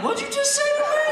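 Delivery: high, loud mock threat; cartoonish high-pitched menace; menacing comeback. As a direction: squeal it like a tough guy picking a fight. Threatening reply in theater.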